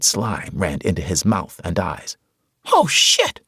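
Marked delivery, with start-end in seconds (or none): 2.7-3.4 high, loud squeal of disgust. A narrator's reading. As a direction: squeal it, drenched in gore. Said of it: "he squealed".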